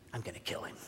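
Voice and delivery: very soft, nearly voiceless aside; muttered threat. Retold or imitated. Dad fed up with his missing son, muttering. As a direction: say it under your breath. Exasperated dad's aside after the search.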